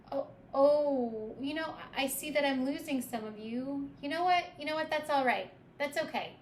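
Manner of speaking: loud, high-pitched reaction to losing listeners; calling after a departing audience. Acted. Tour guide calls after departing listeners.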